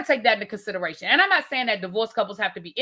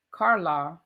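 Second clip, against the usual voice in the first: lower, imitating Marie's accent on "Carla", the end vowel rounded to "Carlo"; 6 semitones lower than usual. Host mimics Marie's accent saying "Carla" for the chat. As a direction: say "Carla" lightly in Marie's accent, not her own. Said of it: "you trying to say that with her, um, accent".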